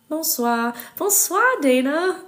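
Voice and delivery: high, loud French hello; enthusiastic greeting. Real, not acted. Calling a greeting to a viewer.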